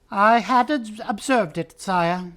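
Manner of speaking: high, shrill mouse voice; Reepicheep. A narrator's reading. Mouse replying formally to the king, shrill voice. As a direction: answer the king in a high pipe. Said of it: "came a shrill voice".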